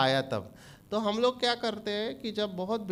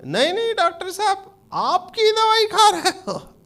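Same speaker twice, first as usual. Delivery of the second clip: high, loud, teary mock denial; wife insisting much higher and louder, half sobbing; wife denying, near tears; 11 semitones higher, 7 dB louder than usual. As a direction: plead innocence in a high, crying voice. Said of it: "आपने इसको कुछ खिलाया है क्या".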